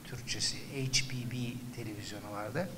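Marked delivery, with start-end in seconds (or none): 0.6-1.3 "H B B" whispered as English letters, "eych bee bee"; English letter names. Shown as a demonstration. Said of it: "Türkçem kötü benim".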